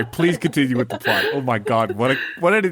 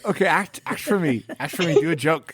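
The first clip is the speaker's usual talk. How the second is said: pushy host; 6 semitones higher than usual. Retold or imitated. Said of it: "mock interviewer".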